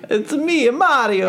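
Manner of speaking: high, hammed-up Italian accent; Mario. Shown as a demonstration. Husband doing Italian on request, high mock Italian video-game hero. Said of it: "an Italian accent".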